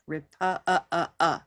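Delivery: "repu-" cut off, then "uh, uh, uh" repeated; isolated stressed vowel. Shown as a demonstration. Teacher pulling out the vowel for learners, "repu-" then repeated "uh". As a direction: stop "repu-" at its vowel and echo that "uh". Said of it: "So what color is that?".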